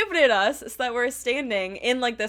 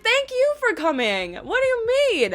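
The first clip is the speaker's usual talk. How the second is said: high-pitched retold protest; gushing disbelief; 9 semitones higher than usual. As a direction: throw the thanks back in amazement. Retold protest at being thanked.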